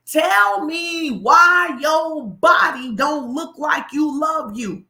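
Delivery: loud, slow, drawn-out challenge; a trainer's booming challenge; retold shout. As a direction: hurl the question at him slowly. Pastor retells trainer's challenge.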